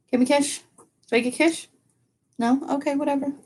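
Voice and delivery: high, soft pleading coo; pet talk to dog. Real, not acted. Owner asking her dog for a kiss, high soft coo. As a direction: beg the dog for a kiss softly.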